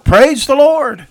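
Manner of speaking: high, loud, drawn-out proclamation; joyful shout. Retold or imitated. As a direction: shout it slowly from the porch. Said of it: "stand on her front porch and shout".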